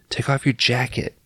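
breathy mock-tender whisper. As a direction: hush it as if tending a wound. Acting out Pam tending Cortez.

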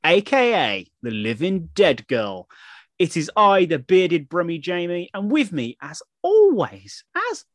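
slow, drawn-out announcer delivery; big theatrical welcome; show host intro. Acted. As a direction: stretch the key words like a grand podcast opener. Performs the show's intro.

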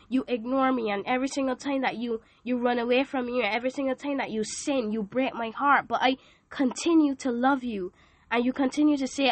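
normal talking voice

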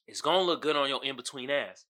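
usual speaking voice

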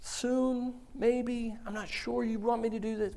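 high, hesitant questioning voice; doubting believer. Acted. A doubting believer questioning God, high and hesitant. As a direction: ask God nervously, stalling.

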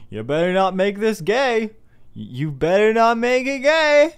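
high, loud hammed-up warning; mock stern God. God voiced warning Noah.

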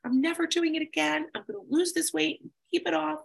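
high, fast, hammed-up resolve; over-emotional vow. Overeater mocking her old vows, high and fast. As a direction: swear it off like a drama queen. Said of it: "all full of that over emotional thing".

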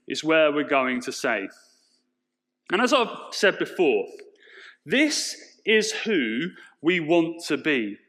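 loud, passionate sermon voice; preaching. Acted. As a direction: preach it out to the congregation. Sermon at full force.

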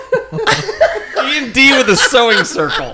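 words squeezed out through laughter; high giggly voice; laughing while talking. Real, not acted. Laughing at own joke.

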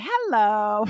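"Hello." drawn out with a lilt; sing-song hello. Shown as a demonstration. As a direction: stretch "Hello." playfully.

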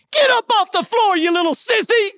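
high-pitched mock scolding; bossy little nurse. Tiny nurse scolding a big crying patient, high mock yell. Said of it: "this little five-foot nurse goes".

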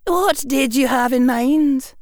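high careful schoolmistress; stern witch. Acted. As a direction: probe gently, stern teacher. McGonagall voiced.

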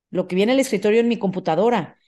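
ordinary delivery

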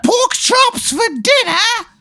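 hammed-up English accent, shouting; bad English accent. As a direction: call out in a silly English accent. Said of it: "you have a bad English accent".

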